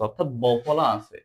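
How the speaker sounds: normal talking voice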